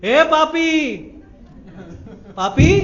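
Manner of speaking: high, loud call across the gate. Acted. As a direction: shout for him to come over. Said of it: "जोर से आवाज दी".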